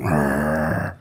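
low mock growl; werewolf growl. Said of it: "a werewolf going".

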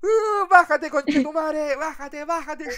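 high, loud shout of bájate; shrill-free hammed-up heckle from the crowd; mock heckling. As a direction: yell her off the stage.

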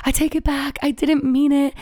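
high, loud, pleading retraction; desperate take-back. Retold or imitated. Driving to her appointment, pleading with God to take it back. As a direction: blurt it out, begging to undo it.

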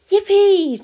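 high, loud whoop of joy; cheering. Acted. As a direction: cheer out loud, pitched right up. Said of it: "cheered Cam and Bron".

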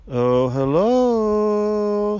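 long, high, drawn-out holler; calling out. A narrator's reading. Father waking the man in the tree, yelled greeting. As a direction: call up to someone in a tree. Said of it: "he yelled".

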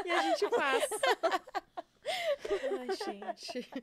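high laugh through the words. Real, not acted. Laughs at the request. (rindo, bem agudo)

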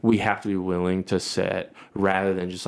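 ordinary talk, nothing marked

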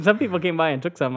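normal talking voice

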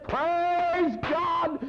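high preacher's shout, words stretched out; imitated pulpit shout of praise; exultant proclamation. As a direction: cry it out high and long like a revival preacher. Preacher acts out exultant reply.

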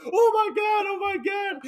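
very high-pitched acted panic; mock screaming panic. Retelling the panic at the bomb vest, mock screaming. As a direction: squeal it as if terrified. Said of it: "starts screaming".

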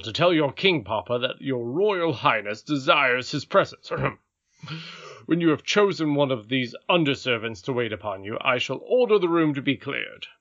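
formal, gallant officer's voice; courtly captain of the guard. Acted. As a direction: declare your orders respectfully to royalty.

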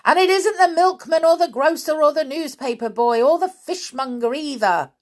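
high, loud, fast exasperated list; cross shouting. A narrator's reading. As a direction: rattle it off at a shout, fed up. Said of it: "getting cross".

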